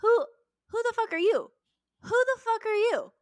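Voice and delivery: high, loud incredulous outrage; outraged. Retold or imitated. Sister confronting brother over betrayal, outraged shriek. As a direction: shriek it in disbelief. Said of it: "And I'm like".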